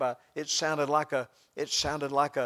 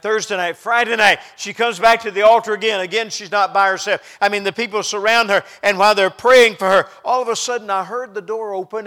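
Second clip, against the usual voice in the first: loud, raised, building sermon cadence; full-force preaching; 5 semitones higher, 15 dB louder than usual. Real, not acted. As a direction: pile it up at full volume.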